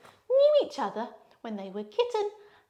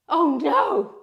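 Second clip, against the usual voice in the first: mock alarm; 3 semitones higher, 6 dB louder than usual. Cat spots the dog.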